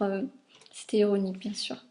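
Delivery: ordinary talk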